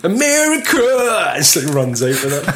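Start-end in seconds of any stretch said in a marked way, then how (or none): 0.0-1.3 high, slow, stretched-out cry; mock patriotic shout. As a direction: bellow it like a cartoon patriot.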